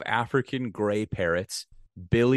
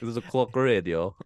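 Borat voice; 2 semitones higher than usual. Retold or imitated. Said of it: "talking in a Borat voice".

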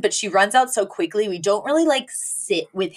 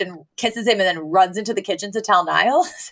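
normal talking voice

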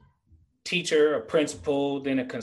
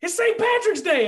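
much higher and louder, in disbelief; incredulous coworker; 5 dB louder than usual. Retold or imitated. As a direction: coworker insisting on the holiday: high incredulous exclaim. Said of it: "so he was like".

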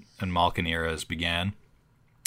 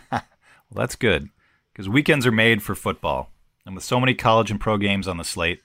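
upbeat sponsor read, a bit louder; 2 semitones higher, 3 dB louder than usual. Acted. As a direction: sell it like a radio spot. Host reads sponsor ad.

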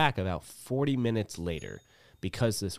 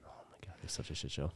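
fast whispered aside; exasperated whisper; 3 semitones lower, 11 dB quieter, faster than usual. Real, not acted. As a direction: breathe it out under your breath, fast.

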